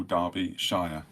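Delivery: Derbyshire ending in "shy-er"; ending as SHIRE. Shown as a demonstration. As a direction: say Derbyshire slowly as DAR-bee-SHY-er. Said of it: "straighten that out".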